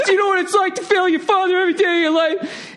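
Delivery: high, fast blubbering plea; imitating crying. Italian owner begging Gordon, high mock sobbing. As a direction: blubber it through tears, hammed-up. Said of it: "He starts crying".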